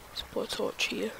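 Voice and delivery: whispered under the breath. Real, not acted. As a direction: mutter it voiceless to yourself.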